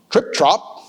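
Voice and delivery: plain reading voice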